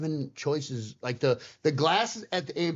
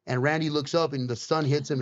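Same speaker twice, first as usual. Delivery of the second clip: ordinary talk, nothing marked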